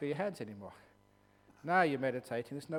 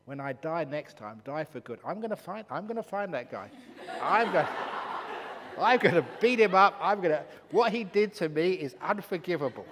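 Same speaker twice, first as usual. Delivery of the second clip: much louder, higher, threats broken by laughter; hammed-up rage that keeps cracking into laughs; laughing mock fury; 4 semitones higher, 12 dB louder than usual. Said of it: "She was so pissed off".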